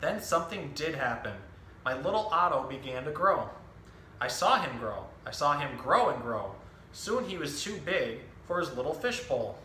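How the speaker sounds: lively picture-book storytelling voice, each line clearly paced; reading to children. Acted. Zookeeper reading a book to children, animated storytelling. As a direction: tell the story to a room of young kids.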